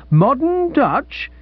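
a dealer stung to the quick, voice leaping high; high incredulous squawk, drawn out; outraged disbelief. Acted.

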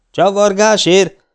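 loud, high, drawn-out incredulous roar; bellowing. A narrator's reading. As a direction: bellow it back in disbelief. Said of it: "bömbölte az őrmester". (hitetlenkedő, elnyújtott bömbölés)

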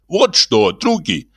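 high-pitched composer addressing his pals; high, theatrical character voice; Ibrahim's self-important address. Acted.

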